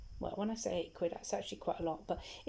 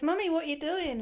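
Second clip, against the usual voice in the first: put-on higher, louder little boy asking; 9 semitones higher, 6 dB louder than usual. The small boy asks his mummy, in a high voice. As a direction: play the puzzled small son.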